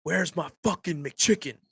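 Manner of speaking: stretched-out yell without full volume; mock angry yell. Angry man at the drive-thru demanding his food, mock yelling. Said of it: "this dude start yelling".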